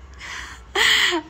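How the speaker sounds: high, bright burst of real laughter. Real, not acted. Reading a fan's cheeky question, bursting out laughing.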